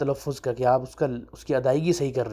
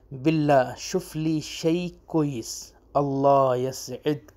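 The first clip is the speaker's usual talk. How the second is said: باللہ شفلی شیء کوئیس اللہ یسعدک said slowly, every syllable spaced out; slow clear demo; much slower than usual. Shown as a demonstration. Teacher to students, saying the Arabic phrase slowly. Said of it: "آہستہ بولتا ہوں میں".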